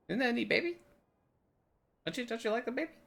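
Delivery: very high cooing voice; playful high-pitched doting; baby talk. Acted. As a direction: coo it like showing off a baby. Showing off a found baby.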